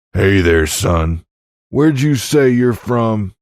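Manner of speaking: loud, slow western call; confronting big cowboy. A narrator's reading. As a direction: hail him across the saloon. Giant calls out to William.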